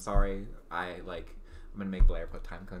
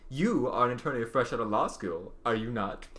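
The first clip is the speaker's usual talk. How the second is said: ordinary delivery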